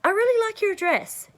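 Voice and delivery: high, loud delighted gush to a stranger; bright street compliment, high and eager. Retold or imitated. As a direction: rave about her dress with excitement. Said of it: "Like, I might just say".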